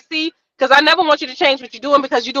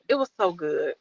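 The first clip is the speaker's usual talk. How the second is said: quiet; 8 semitones lower, 12 dB quieter than usual. Real, not acted.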